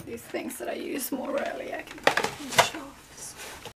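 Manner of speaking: whispered aside to the camera. Real, not acted. Vlogger whispering her plans to viewers.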